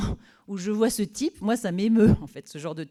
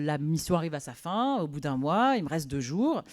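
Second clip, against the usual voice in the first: normal talking voice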